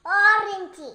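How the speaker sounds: loud, proud shout of orange; said loudly. Real, not acted. Child shouts the fruit name when mother asks, loud. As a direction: belt the word out on request. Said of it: "എന്ത് ഉറക്കെ പറ".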